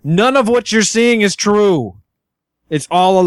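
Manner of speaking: pleading call, pitched high; yelling. Acted. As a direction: call him back, urgent and pleading. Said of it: "add to my yelling".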